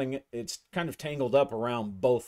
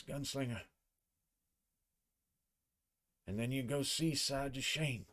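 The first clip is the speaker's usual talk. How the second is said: slurred prim voice; 3 semitones higher, 6 dB quieter than usual. Acted. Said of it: "his prim voice is now, uh, it's a slur".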